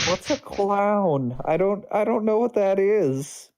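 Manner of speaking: high-pitched naive dread; frightened innocent. Acted. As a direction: ask in a high, worried whine. Mord asks, frightened, in character.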